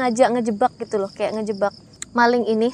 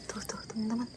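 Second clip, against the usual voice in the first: frightened whisper; 17 dB quieter than usual. Real, not acted. Scared host in a dark forest.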